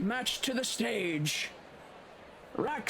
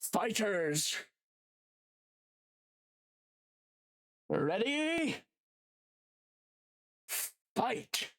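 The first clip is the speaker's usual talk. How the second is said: hyped arena announcer calling the fight; booming fighting game announcer; 2 semitones higher, much slower than usual. Acted.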